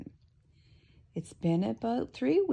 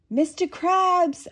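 high reporter; 11 semitones higher, 6 dB louder, slower than usual. Acted. Reporter voiced.

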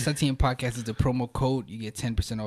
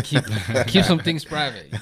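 laughing; 3 semitones higher, 8 dB louder, slower than usual. Real, not acted. Laughing at the guest's joke.

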